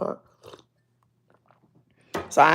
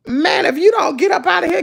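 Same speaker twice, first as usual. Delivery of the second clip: exclaiming; 8 semitones higher, 4 dB louder than usual. Real, not acted. Exclaims at viewers.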